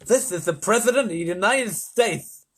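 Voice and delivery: lisping, rasping head of state; rasping President. A narrator's reading. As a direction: announce yourself with pompous authority. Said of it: "It was deep and rasping".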